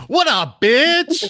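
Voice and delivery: loud, high-pitched playful call-out; brash mock greeting. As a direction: holler it like a cheeky shout-out to a pal. Mock shout-out to famous actor.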